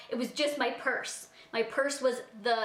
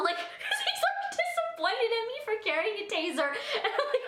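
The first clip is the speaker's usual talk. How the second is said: high, laughing through the words; can't stop laughing while telling it; 10 semitones higher than usual. Real, not acted. Telling about her disappointed dad while laughing.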